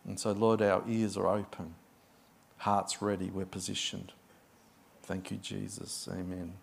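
soft, low prayer voice. Acted. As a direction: pray quietly and reverently. Opening prayer.

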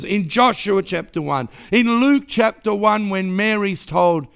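loud, rising preaching run; full-force preaching. Acted. Preacher listing blessings to the church, loud and building. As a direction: build it like a sermon climax.

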